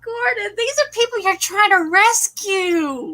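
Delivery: high, wailing mock reproach; hammed-up high-pitched scolding; mock scandalised. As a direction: plead with him in exaggerated dismay. Continues mock scolding of the character.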